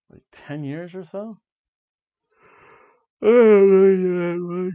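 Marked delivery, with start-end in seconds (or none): 3.2-4.7 slow, drawn-out, much louder and higher; exasperated cry. Real, not acted. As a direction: let it burst out, stretched long. Exasperated outburst over copyright.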